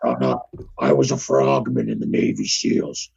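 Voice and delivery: very low, gravelly-free deep rumble; Jesse Ventura impression. Playing Jesse Ventura for cohost, very low voice. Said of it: "advertisement for the episode as Jesse the Body".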